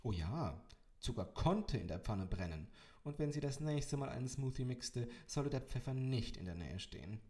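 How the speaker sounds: plain reading voice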